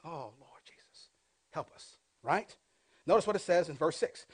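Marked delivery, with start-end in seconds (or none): 0.0-2.0 a soft, breathy aside to the Lord; hushed plea to Jesus; whispered prayer. Real, not acted. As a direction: breathe a quick prayer under your breath. Whispered aside prayer mid-sermon.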